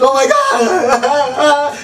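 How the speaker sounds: high, loud, drawn-out wail; hammed-up tearful cry; mock crying. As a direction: imitate a woman bawling hysterically. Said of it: "She's crying. Her tears are like this".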